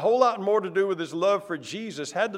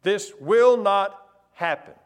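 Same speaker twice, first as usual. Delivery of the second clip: slow, louder repeated refusal; mock insistent Peter; 2 semitones higher, 6 dB louder than usual. Peter repeating his refusal to Jesus, slow and louder. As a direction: insist again, hammering it. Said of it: "He was scolding Jesus and said".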